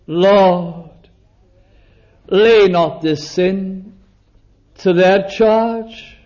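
ordinary talk

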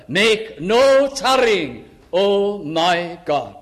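very slow, stretched plea; a slow, searching cry to God; slow earnest plea. Retold or imitated. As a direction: draw out each word like a prayer. Praying the psalm, drawn out.

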